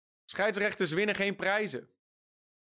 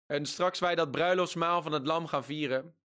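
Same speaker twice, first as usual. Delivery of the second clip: ordinary talk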